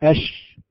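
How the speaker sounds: أش with a weak, short sh; short wrong sh. Shown as a demonstration. Teacher isolating the wrong short sh. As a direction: give أش only a quick, short sh. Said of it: "ليس هذا تفشيا".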